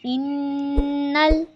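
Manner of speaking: इन्नल with the doubled noon nasal for two counts; full ghunna. Shown as a demonstration. Teacher holding the nasal ghunna. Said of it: "नून मुशद्दद का गुन्ना पूरा करना है".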